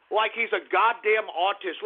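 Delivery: ordinary talk, nothing marked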